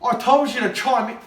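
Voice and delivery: loud, high scolding shout; angry scolding. Acted. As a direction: tell him off loudly. Scolding silent co-host.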